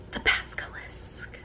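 hushed whispered reveal. Real, not acted. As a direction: breathe the name out. Revealing a pin.

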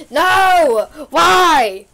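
loud high no and why; anguished shout. Real, not acted. Gamer whose phone ruined the video, loud shout. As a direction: cry out at the ruined take.

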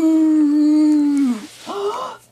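loud drawn-out gasp; long dramatic gasp. Acted. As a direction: gasp loudly in shock.

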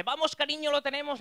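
cheering husband. Retold or imitated. Husband cheering during birth.

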